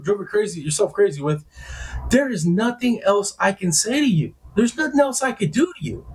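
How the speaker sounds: high-pitched, insistent reenacted protest; exasperated denial. Denying he is a cheater, exasperated. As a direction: replay the argument, pleading innocence at the top of the range.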